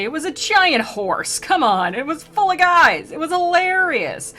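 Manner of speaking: high-pitched, exasperated gushing rant; excited defence. Real, not acted. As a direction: argue it with comic outrage, stretched high. Ranting defence of the Trojan horse.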